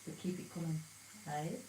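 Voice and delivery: monotone. Real, not acted. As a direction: keep it monotone to hide laughing. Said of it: "so I'm speaking monotone now".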